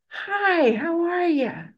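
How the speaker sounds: high, drawn-out cheery "hi"; loud friendly greeting. Retold or imitated. Old man hailing an angry drunk, loud and very friendly. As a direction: pitch it way up, bursting with friendliness. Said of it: "ear-splittingly loud, very friendly".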